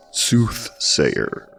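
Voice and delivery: ordinary delivery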